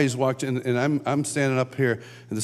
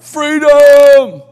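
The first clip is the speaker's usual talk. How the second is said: huge, high, drawn-out battle cry; Braveheart yell; 12 semitones higher, 15 dB louder than usual. Acted. Worship leader rousing the conference crowd, full-voiced yell. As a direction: belt it out long and loud. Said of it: "he yells out".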